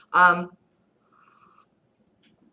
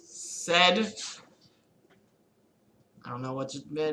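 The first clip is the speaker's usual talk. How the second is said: ordinary talk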